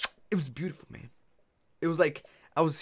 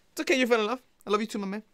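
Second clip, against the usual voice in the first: playful voice pitched up high; high teasing voice; 9 semitones higher, 3 dB louder, much faster than usual. Pretend. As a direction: tease him sweetly, way up high. Teasing chat about love confession.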